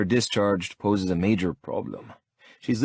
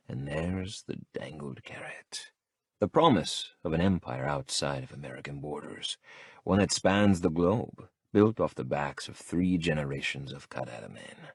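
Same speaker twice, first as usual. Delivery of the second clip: usual speaking voice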